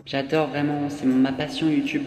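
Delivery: slow, soft, gushing influencer parody; mock a fake-sincere YouTuber. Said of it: "l'influenceur en mode".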